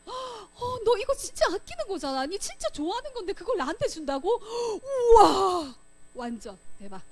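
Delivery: high, thrilled gasp of gratitude; moved and amazed. Acted. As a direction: build from disbelief to a big loud wow. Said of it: "그 친구의 감동".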